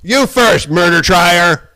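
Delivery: high, loud furious yelling; angry shout. Real, not acted. Man confronting someone he blames, furious shout.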